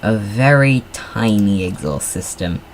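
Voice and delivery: ordinary talk, nothing marked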